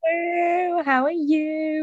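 high, drawn-out Southern American greeting; Southern American accent. Pretend. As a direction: put on a slow Texan twang. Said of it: "I don't know what that accent was".

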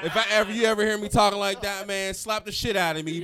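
loud, high, forceful pep talk; acted-out shouted self-correction; imitated rowdy rallying. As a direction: holler it, imitating a fired-up friend.

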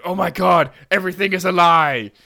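loud, high outraged gasp; shocked outcry. Retold or imitated. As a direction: cry out in mock betrayal. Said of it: "they're just like".

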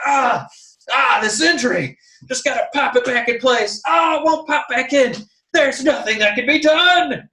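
high, loud mock cries of pain; acted injury agony. Host mimicking an injured wrestler fighting pain, loud agony. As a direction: play a hurt athlete, rising in desperation. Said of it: "it's not like they're like".

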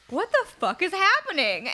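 high, loud, slow disbelief; shocked. Retold or imitated. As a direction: gasp it out in horror. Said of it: "I was like".

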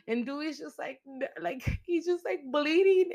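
real upset rising as she recounts it; getting emotional. Real, not acted. Fan retelling a favorite character's death, voice rising with real emotion. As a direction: voice climbing high, close to tears. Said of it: "I'm getting emotional right now".

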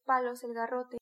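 ordinary delivery, nothing marked

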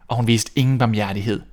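plain reading voice

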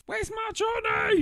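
high, loud film impression; hammed-up high shout; mock wail. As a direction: drag it out in a high mock cry. Re-enacting a film character waking up.

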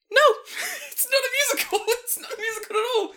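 high-pitched, loud denial through laughter; laughing protest. Retold or imitated. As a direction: insist quickly while cracking up, voice shooting up. Retold denial, cracking up.

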